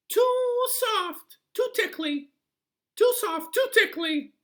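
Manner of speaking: very high, loud squeaky complaint; a fussy little sprite shrieking its gripe; high squeaky goblin whine; tiny creature voice. A narrator's reading. Hudgeon complains about the bed.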